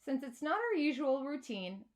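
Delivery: ordinary delivery, nothing marked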